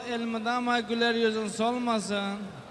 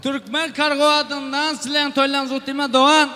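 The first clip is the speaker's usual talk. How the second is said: shouted announcement; 4 semitones higher, 10 dB louder than usual. Real, not acted. Host announces sponsor's wish.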